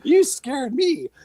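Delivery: high, slow, startled what is wrong with you; startled retort. Retold or imitated. Telling a friend off after his sudden yelp, high and slow. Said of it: "I'm like".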